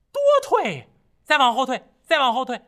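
high, loud monkey cry; monkey calling. A narrator's reading. Monkey calling his master from under the mountain, high and loud.

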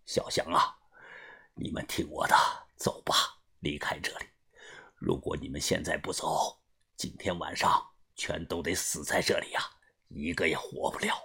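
极低极轻，带着气声; 一句一顿，沉重地低语相劝; 含泪劝告. A narrator's reading. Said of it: "老福擦了擦眼泪". (擦着泪低沉地劝人离开)

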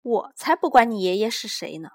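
sharp, loud comeback; retort. A narrator's reading. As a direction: talk back to him with scorn. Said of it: "女店员顶他一句".

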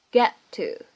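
"get to" with the t of "get" held, unreleased; loss of plosion. Shown as a demonstration. Teacher repeats for learners, "get to" with unreleased t. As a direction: hold the t of "get" before "to". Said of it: "可以有一个失去爆破".

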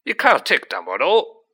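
indignant giant bellowing; yelling. A narrator's reading. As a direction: yell in protest. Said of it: "yelled Hagrid".

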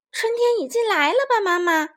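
put-on high, lively child's question; eager little girl asking. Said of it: "玛塔问".

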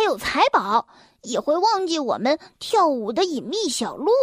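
lightly voiced elves, lively swooping tune; elves' chatter. Elves grumbling that Red-head will leave, animated character voice. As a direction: gossip like slightly put-out elves. Elves gossip about the growing boy.

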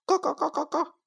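high clucking like a hen; boy copying hen. A narrator's reading. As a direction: imitate the hen's cluck. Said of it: "小明又学着叫起来".

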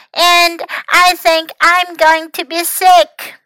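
loud, high lament; groaning. A narrator's reading. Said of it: "he groaned".

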